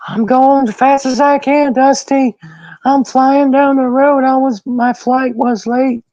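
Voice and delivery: very high squealing mock panic; panicked excuse-maker. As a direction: plead your case way up high. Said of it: "the guy was like".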